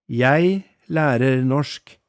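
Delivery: very slow, each word separate; a teacher models the phrase, very slowly. Shown as a demonstration. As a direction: say it slowly for learners to copy. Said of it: "I'll say it slowly".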